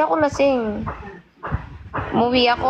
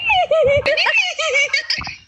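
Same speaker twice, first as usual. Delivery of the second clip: high-pitched burst of laughter. Real, not acted. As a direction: squeal with laughter. Laughing at own story.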